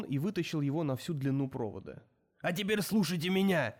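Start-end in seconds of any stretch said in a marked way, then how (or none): 2.4-3.7 loud gruff bark; barking into microphone. A narrator's reading. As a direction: bark it through the PA. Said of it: "рявкнул он".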